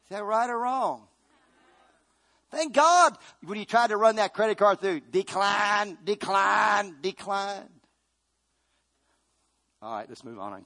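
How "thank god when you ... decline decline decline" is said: slow, loud, high preaching cadence; preaching at full force. Acted. Preacher to congregation, slow rising sermon cry. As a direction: hammer each question out to the church. Mimics card declining.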